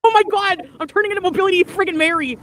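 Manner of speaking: high, loud flustered outburst; real panic. Real, not acted. Streamer panicking on her scooter, high loud outburst.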